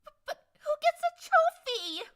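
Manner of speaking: worried. Acted. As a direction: fret over the lost prize. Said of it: "Trixie asked worriedly".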